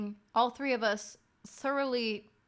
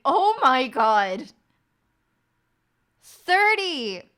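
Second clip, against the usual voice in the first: loud, slowed, higher gasp of horror; stunned disbelief; 5 semitones higher, 10 dB louder, much slower than usual. Retold or imitated. Speaker reacts to sister, loud and stunned. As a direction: reel at the number.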